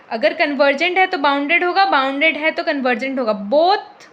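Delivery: loud, punching both at the end; emphatic both ways. Shown as a demonstration. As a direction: hammer home that it holds both ways. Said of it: "का मतलब ही यही होता है".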